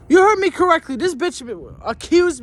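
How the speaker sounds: shouted high and loud; screaming. Real, not acted. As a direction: hammer it at the audience, furious. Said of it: "for screaming".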